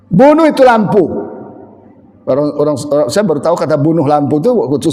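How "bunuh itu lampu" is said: gruff Batak husband. Retold or imitated. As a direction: bark "Bunuh" hard and falling in a Batak accent. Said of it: "kalau orang Batak lebih dahsyat". (logat Batak keras, "Bunuh" ditekan turun)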